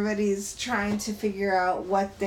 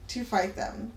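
ordinary talk

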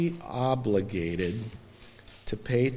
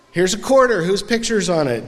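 1 loud quiz; 8 semitones higher, 7 dB louder, faster than usual. Acted. Teacher-like quiz to congregation.

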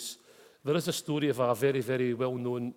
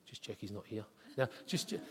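hushed aside; 6 semitones lower, 10 dB quieter than usual. Real, not acted. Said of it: "shall I just say".

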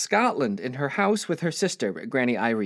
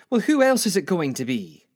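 brisk Scottish granny, pitched up; higher Scottish old-lady voice; 4 semitones higher, 4 dB louder than usual. Acted. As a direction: retort as an impatient Scottish grandmother. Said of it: "I see my Granny Ella from Scotland".

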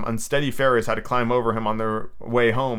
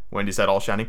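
ordinary delivery

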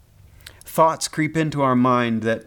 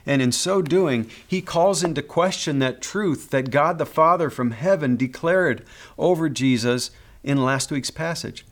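ordinary delivery

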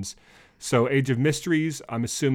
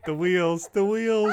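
laughing; 10 semitones higher, slower than usual. Real, not acted. Co-host's joke cracks them up.

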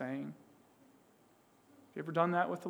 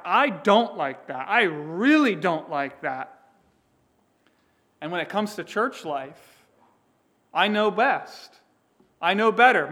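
loud, boastful Satan, slowing on I don't like that; voicing Satan's pride; 2 semitones higher, 9 dB louder than usual. Preacher voicing Satan to the congregation, loud and boastful. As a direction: puff up and crow like a proud tempter.

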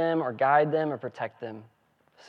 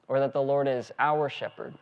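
usual speaking voice

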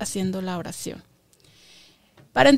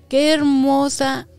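slow, loud marvelling; admiring exclamation; 3 semitones higher, 7 dB louder, slower than usual. Real, not acted. Teacher marvels at the verse to her class, slow and loud. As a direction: savour the beauty of the verse, louder.

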